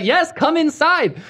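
high, slow mock Indian accent; cheerfully oblivious Indian-accented imitation, through laughter; Ray. Ray repeating himself to the confused caller, imitated Indian-sounding accent. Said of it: "Why does he sound Indian?".

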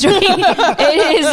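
laughing through the words; can't stop giggling. Real, not acted. Laughs at own joke.

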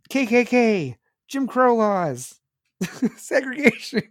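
lightly ape her as a whining caricature; mock congresswoman. Podcaster mimicking AOC, sneering high mock voice. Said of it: "she'd be under there like".

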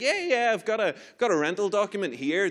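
high-pitched, placating yeah-yeah; nervous eager reply. Retold or imitated. Driver answering trooper about proof of ownership, nervous.

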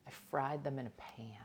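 breathy whisper. Real, not acted. Whispered repeat of the frying.

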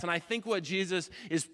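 usual speaking voice

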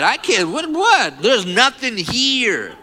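high, incredulous outburst; acted disbelief. Man finding his arm muscle gone, incredulous. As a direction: pitch shoots up in mock shock, last words drawn out.

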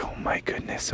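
frightened whisper; hushed, nervous. Acted. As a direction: breathe it out scared. Scared in spooky park.

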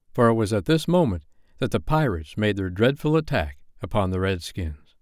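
ordinary delivery, nothing marked

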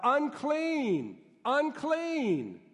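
high, drawn-out warning call; acted leper's cry, pitched well up; lepers' warning yell. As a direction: call out a warning to keep people away. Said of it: "put up their hand and yell out".

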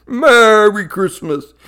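slow, loud Santa greeting. Acted. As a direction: draw it out like Santa.

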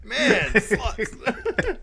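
high, loud quote through laughter; laughing quote. Retold or imitated. Co-host repeats Trapster's line, laughing hard.

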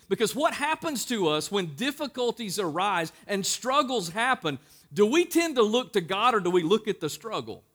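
loud, high, driving sermon delivery; full-force preaching. Acted. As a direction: preach it out to the whole room.

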